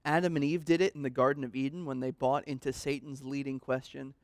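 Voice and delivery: normal talking voice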